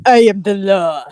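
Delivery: a hint of action-star impression; Sylvester Stallone. Friend jokes about a Judge Dredd line, mock impression. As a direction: lightly put on a movie tough guy. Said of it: "do a, uh, Sylvester Stallone impression".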